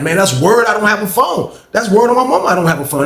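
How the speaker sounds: high-pitched protest of innocence; insistent denial. Retold or imitated. Swearing to Leroy there's no phone, high insistent denial.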